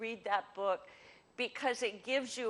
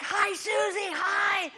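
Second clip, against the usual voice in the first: loud, high gushing hello across the room; boisterous greeting; 9 semitones higher, 8 dB louder than usual. Retold or imitated. Sanguine greeting a friend across the restaurant, shouting excitedly. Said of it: "they're loud and boisterous".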